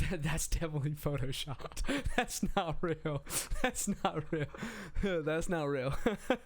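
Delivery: laughing through the words, can't believe it; laughing disbelief. Real, not acted. Mocking a photo of his crush with a guy, laughing. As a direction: giggle it out, calling the photo fake.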